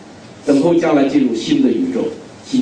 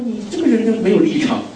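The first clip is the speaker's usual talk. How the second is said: mock critics; 6 semitones higher than usual. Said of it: "有好多朋友们说".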